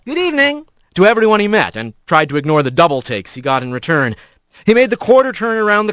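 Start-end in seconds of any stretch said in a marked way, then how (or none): 0.0-0.7 higher-pitched friendly hello; a bright lift in pitch on a passing hello; cheery greeting. A narrator's reading. Rat greeting everyone he met, cheery and higher.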